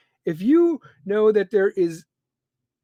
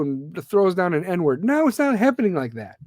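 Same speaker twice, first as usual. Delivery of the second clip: usual speaking voice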